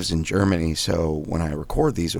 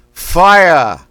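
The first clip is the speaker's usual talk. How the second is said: Boston accent; 10 semitones higher, 12 dB louder than usual. Shown as a demonstration. Coach demonstrating Boston vowel drill, loud thrust-out Boston Fire. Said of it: "We say fire like a Boston accent".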